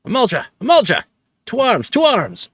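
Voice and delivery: very high shouted warning; rally the soldiers to arms; urgent high-pitched battle alarm; alarm cry. Acted.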